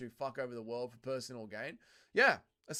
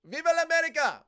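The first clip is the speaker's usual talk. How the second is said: loud, high-pitched patriotic shout; rousing cheer; 8 semitones higher, 11 dB louder than usual. Real, not acted. Streamer hypes up chat with a mangled American slogan, loud cheering. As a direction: holler it like a fan cheering on a fighter.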